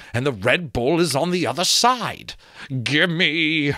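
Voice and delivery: a skull craving wine, pitching up; greedy talking skull. Acted. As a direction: wheedle and grab, ending in a greedy demand. Skull character voice.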